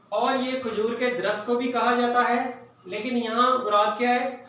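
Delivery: normal talking voice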